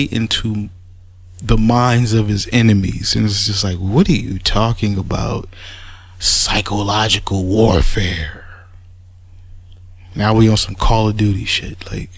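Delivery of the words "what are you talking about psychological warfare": hushed mocking whisper of his claim. Mocking the rival's claim in a hushed whisper. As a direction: breathe it like a secret trick.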